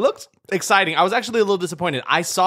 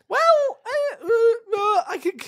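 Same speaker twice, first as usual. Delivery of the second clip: squeaky disbelief; much slower than usual. Real, not acted. Stunned at comedy label.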